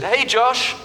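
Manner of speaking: high hailing call across a room; cheerful shout of greeting. Retold or imitated. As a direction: call his name to catch him. Stranger calling out at airport.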